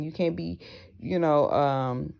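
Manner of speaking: normal talking voice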